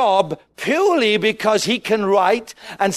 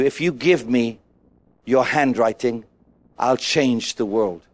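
voice of God; 6 semitones lower than usual. Retold or imitated. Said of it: "the voice of God comes out".

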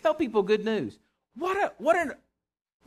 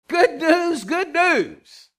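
"Good news, good news" called out high and loud; jubilant proclamation; 9 semitones higher, 6 dB louder, much slower than usual. Retold or imitated. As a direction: herald it to the shepherds. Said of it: "they swooped down and said".

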